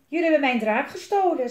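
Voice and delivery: high, loud indignant charge; loud accusation. A narrator's reading. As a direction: accuse them of theft out loud. Fat man accuses the children.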